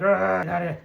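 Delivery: high, loud cry of flight; mock panic. Adventurers running from the transforming wizard, mock panicked cry. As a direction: yelp it like fleeing in a hurry. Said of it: "start running out of here".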